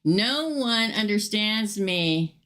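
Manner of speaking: slow, drawn-out, mock-tragic; milk each word like a moody teen; overdone slow lament; slow dramatic reading. Shown as a demonstration. Rereads the card for effect.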